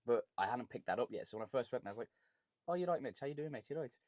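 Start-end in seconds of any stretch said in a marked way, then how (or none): 2.7-3.9 higher-pitched Brummy greeting; Birmingham accent. Retold or imitated. Traveller greeting Mitch on first meeting, Birmingham accent.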